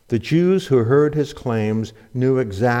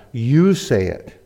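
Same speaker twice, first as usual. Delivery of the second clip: YOU stretched out and punched; slow, emphatic YOU, throwing it back; stress on YOU; slower than usual. Retold or imitated. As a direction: put all the weight on YOU. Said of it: "she added the inflection".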